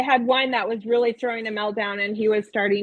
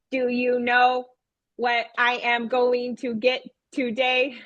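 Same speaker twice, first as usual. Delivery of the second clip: slow robotic monotone question. Retold or imitated. Teacher as robot puppet teasing the class with news, robotic monotone. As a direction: ask it like a robot, word by evenly spaced word. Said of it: "I talked like that".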